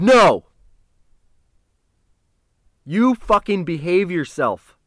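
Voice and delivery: loud, high burst of reproach; stern scolding. Retold or imitated. Man refuses the kid's high five, loud stern scolding. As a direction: shout the refusal, then scold him.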